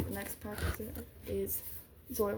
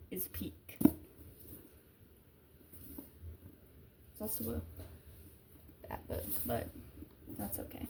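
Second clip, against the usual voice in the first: whisper. Real, not acted. Vlogger whispers a closing verdict on the book to viewers. Quiet closing remarks to camera.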